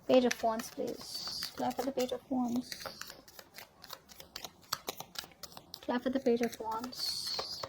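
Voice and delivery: very soft, near-whispered request; barely voiced, under the breath; hushed card prompt. Real, not acted. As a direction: murmur it to the cards while shuffling. Murmuring to cards while drawing them.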